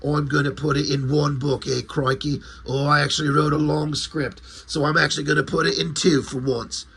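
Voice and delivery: mock British accent; hammed-up English writer's voice; Alan Moore. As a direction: put on a broad English accent. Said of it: "And Alan Moore's like".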